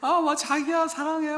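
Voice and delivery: high, airy, mincing "자기야 사랑해" with a teasing lilt; mock Seoul sweet talk. Preacher teasing Seoul men's proposals, in a high, mincing mock croon. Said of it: "서울 사람들은".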